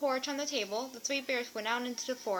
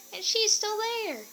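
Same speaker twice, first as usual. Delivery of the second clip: high, slow, louder alarm; alarmed Baby Bear; 11 semitones higher, 4 dB louder, slower than usual. A narrator's reading. Baby Bear discovering Goldilocks still in his bed, alarmed. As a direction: point her out in shocked little voice.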